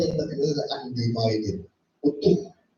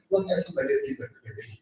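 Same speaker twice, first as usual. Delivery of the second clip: much higher and softer, sweet-talking; sheepish coaxing; 6 dB quieter, much faster than usual. Retold or imitated. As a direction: coax God back like a sheepish child. Coaxing God back after ranting. (membujuk dengan suara tinggi dan lembut)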